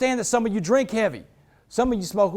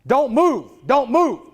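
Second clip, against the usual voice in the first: raised, higher shouted command; screamed police order; 4 semitones higher, 4 dB louder, much slower than usual. Retold or imitated. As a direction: imitate an officer yelling orders. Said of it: "he's screaming at me".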